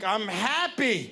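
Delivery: slow, emphatic reply to the ex; pointed "happy". Retold or imitated. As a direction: drag it out pointedly. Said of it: "I'm like".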